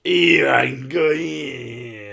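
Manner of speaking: loud, long-drawn mock Scottish cry; exaggerated Scottish accent. Friend showing what Scottish sounds like, exaggerated Scottish accent. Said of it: "Go listen to the Count, Count Dankula".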